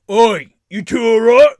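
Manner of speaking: loud call through a wooden door, pitched high. Acted. Bandit calls loudly to his men behind the door. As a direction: bellow Oi to check on his men. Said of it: "and you hear".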